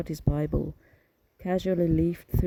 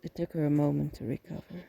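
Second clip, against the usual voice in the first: ordinary delivery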